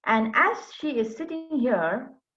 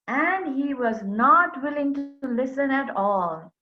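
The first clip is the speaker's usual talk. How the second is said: ordinary delivery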